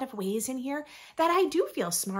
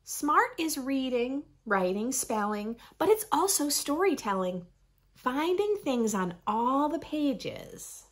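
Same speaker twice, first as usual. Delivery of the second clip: lively, sing-song picture book reading; reading to children; slower than usual. Acted. Reading a rhyming book to young listeners, bright storytime voice. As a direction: read it like storytime for little ones.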